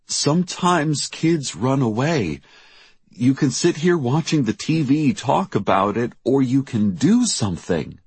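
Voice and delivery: high, forceful persuasion; urging. A narrator's reading. Jackie telling worried mother to do something, urging.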